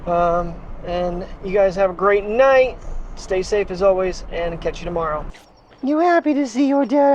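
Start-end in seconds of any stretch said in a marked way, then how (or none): 5.8-7.1 high, sweet voice for the dog. Real, not acted. Owner cooing to his sick dog, high baby talk. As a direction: coo at the puppy.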